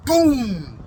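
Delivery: one loud, high boom; imitated bang.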